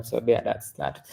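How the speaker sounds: ordinary talk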